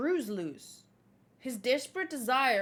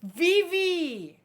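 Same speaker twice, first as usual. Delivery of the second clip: loud, raised cry of the name; excited call; 5 semitones higher, 7 dB louder than usual. Real, not acted. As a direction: call out to a favourite character. Excited fan calls character again.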